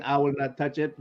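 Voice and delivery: normal talking voice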